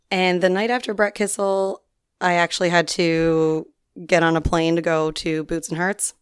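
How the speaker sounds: usual speaking voice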